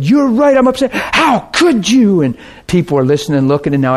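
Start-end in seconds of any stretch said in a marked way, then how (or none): 0.0-2.2 high-pitched, indignant mock cry; upset outburst. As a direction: imitate a wounded woman lashing out. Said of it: "this lady just unloaded on me".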